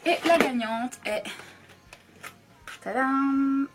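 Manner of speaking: slow build to a loud tadam; playful fanfare reveal; drumroll reveal. Real, not acted. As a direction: announce the winner like a game show. Announcing the winning palette.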